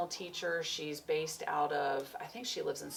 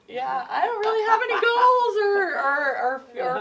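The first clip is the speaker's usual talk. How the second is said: laughing; 15 dB louder than usual. Real, not acted. Admitting she has no goals.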